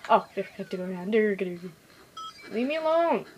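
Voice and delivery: normal talking voice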